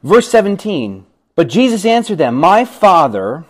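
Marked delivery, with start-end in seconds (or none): 2.3-3.4 drawn-out "My Father"; slow emphasis. Acted. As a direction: stretch it out deliberately. Jesus quoted slowly.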